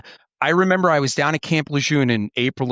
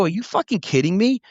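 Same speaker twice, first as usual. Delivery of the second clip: raised pitch, indignant disbelief; retold disbelief; 8 semitones higher than usual. Officer telling young snipers, retold indignant disbelief. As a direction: sound stunned that they got yelled at. Retelling his outrage to the snipers.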